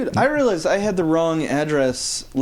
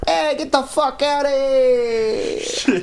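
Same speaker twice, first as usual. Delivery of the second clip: slow, higher New York Italian tough guy; Italian American accent; 7 semitones higher, much slower than usual. Retold or imitated. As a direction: drawl it out like a Brooklyn wiseguy. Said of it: "That's not Boston either. That's Italian".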